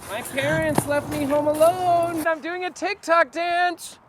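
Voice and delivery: pretend kid. Pretending to be a kid to lure La Llorona. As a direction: play a little kid to bait the ghost. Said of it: "just pretend to be kids".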